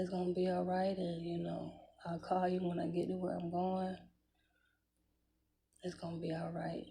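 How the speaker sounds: soft, gentle comfort; reassuring. Retold or imitated. Son reassuring his crying mom at the car, softly. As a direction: ease her tears quietly.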